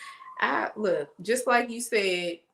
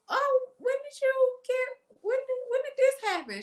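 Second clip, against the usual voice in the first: much higher, excited disbelief; imitating mom gushing with surprise; mom's amazed questions. Mom quizzing the pregnant neighbour, high amazed gush.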